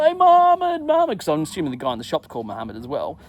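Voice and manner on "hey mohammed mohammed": high, loud mock call for help; imitated high-pitched holler; yelling out. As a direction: call out desperately to someone out of sight. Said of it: "I'm yelling out".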